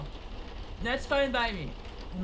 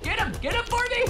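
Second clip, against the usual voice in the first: panicked pleading; faster than usual. Real, not acted. Urging others against enemy.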